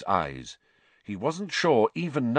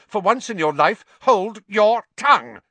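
angry shout; 11 semitones higher, 9 dB louder than usual. Acted. Snape silencing Hermione.

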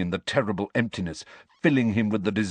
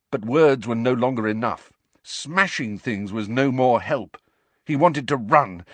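ordinary delivery, nothing marked

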